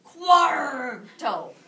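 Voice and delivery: loud pirate-style Cuarto with a rolled ar. Shown as a demonstration. Teacher calling out to the class in a loud pirate voice. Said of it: "pirates like to show up".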